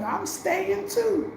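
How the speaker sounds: high, slow, drawn-out resolve; defiant friend. Retold or imitated. Friend telling officials she won't leave, slow and defiant. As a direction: plant her feet, stretched out and high.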